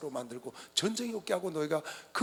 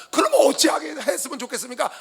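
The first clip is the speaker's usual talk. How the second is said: loud, higher-pitched earnest question; raised, urgent appeal; people asking God; 7 semitones higher, 12 dB louder than usual. Acted. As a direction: call out as a people seeking guidance. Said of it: "하고 물어보니까".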